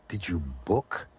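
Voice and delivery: mock-puzzled quoting of an odd phrase; puzzled echo. Mimics puzzled friends repeating his phrase.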